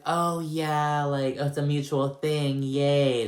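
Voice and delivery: mock Erica's fake cheer; mock stupid sarcasm. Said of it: "being all stupid".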